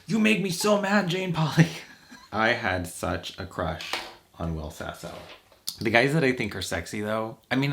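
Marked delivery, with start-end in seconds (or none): 0.0-1.7 high, sulky sore-loser whine; pouty impression of a beaten man; George Lucas impression. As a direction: whine it like he just lost. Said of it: "I just remember him going".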